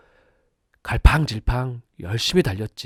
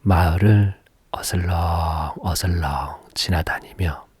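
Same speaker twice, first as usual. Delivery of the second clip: usual speaking voice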